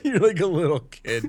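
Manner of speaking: teasing through laughter; laughing. Real, not acted. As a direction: laugh as you tease him. Teasing friend while laughing.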